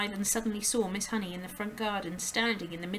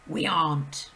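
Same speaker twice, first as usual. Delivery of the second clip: Mister Wormwood; 3 semitones lower, 8 dB louder than usual. A narrator's reading. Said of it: "the father said".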